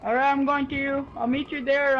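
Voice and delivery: ordinary talk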